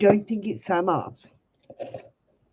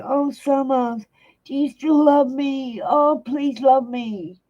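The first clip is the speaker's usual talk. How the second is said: higher, slow begging whine; hammed-up desperate lover; mock needy plea; 6 semitones higher, slower than usual. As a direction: beg like a clingy girlfriend.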